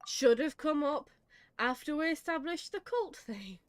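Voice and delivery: ordinary delivery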